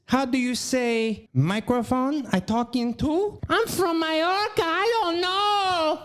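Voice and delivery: mock a fake Spanish accent, stretching the end; Hilaria's Spanish accent. Comedian mocking actor's wife on air, hammed-up Spanish accent. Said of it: "puts on her Spanish voice".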